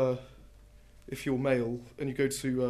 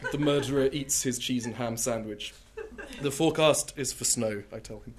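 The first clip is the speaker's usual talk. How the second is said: deadpan poetry reading, punchy lines; poem reading; 7 dB louder than usual. Acted. Poet reading The Murderer to an audience, deadpan. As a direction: read it like a serious poet with comic timing.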